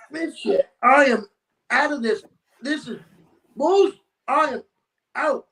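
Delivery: high, punchy retold exit line in chunks; emphatic bailing out. Renter tells his companion he is leaving, emphatically fed up. Retold decision to flee the house.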